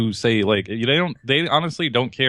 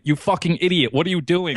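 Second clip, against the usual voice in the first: higher-pitched pretend telling-off; mock scolding; 7 semitones higher than usual. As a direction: scold in mock outrage, half laughing. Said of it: "I wasn't gonna be like".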